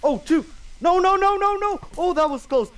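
very high, frantic shrieks; panicked shrieking. Real, not acted. Narrow escape from creepers.

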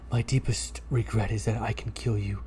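low, soft godlike voice; deep god voice. Acted. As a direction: speak as an ancient cat god. Cat god from beyond.

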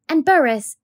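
teacher asking a dirty pupil to spell his name; flustered teacher. Acted. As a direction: coax the boy politely, a little flustered.

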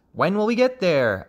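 high impatient little question; restless frog child. A narrator's reading. Young frog hanging from the hawk, high impatient asking. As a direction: ask high, like a child on a trip.

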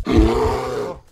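sudden loud cry of alarm; startled yell. Real, not acted. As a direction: yell out in fright. Bear attacks, sudden loud cry.

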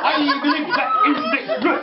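high-pitched mock congressman; congressman impression. Imitating a congressman for a laughing audience, mock politician voice. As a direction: put on a regional politician's voice. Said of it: "congressman talking like that".

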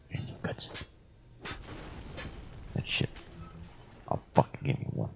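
breathy whispered repeat; sneaky hushed aside. Acted. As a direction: whisper "I need some goods" with no voice at all.

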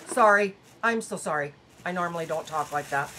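embarrassed, quietly taking the curse back; hushed apology. Real, not acted. Vlogger softly apologising to viewers for swearing.

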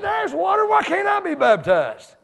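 a eunuch eager to be baptized. Acted. Preacher voicing the eunuch begging Philip for baptism, pleading.

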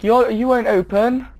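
ordinary delivery

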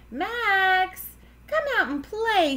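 high, singsong calling voice; hollering. A narrator's reading. Froggy at Max's house, hollering for him. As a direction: call a friend out to play. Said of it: "he hollered".

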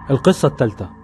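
usual speaking voice

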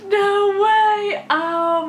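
high, loud laugh into no way; giddy disbelief. Real, not acted. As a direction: squeal it, thrilled. Giddy about hearing the song live.

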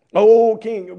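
loud, high call to the king; ringing oh king; bold reply. Acted. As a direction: address the king defiantly. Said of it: "one of the greatest statements".

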